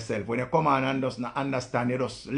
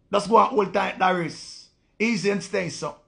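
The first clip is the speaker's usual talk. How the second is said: mocking loud send-off; 7 semitones higher, 4 dB louder than usual. Real, not acted. Mock farewell to viewer.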